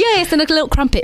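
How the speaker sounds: high-pitched mock British accent. Guest playing along over tea and crumpets, high mock British accent. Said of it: "I love the British accent".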